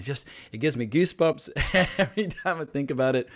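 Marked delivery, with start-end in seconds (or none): none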